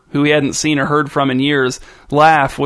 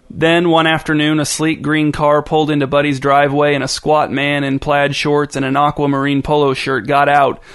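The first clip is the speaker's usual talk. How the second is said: ordinary delivery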